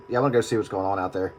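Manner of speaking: ordinary talk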